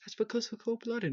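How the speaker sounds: high put-on cartoon voice; squeaky character imitation; Lenny. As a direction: quote it in a high, silly voice. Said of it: "when Lenny says".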